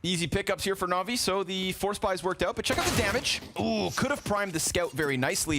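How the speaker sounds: high, quick-fire esports call; live play-by-play. Real, not acted. Caster reports easy kills for Navi, high excited play-by-play. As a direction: pitch up and punch the damage.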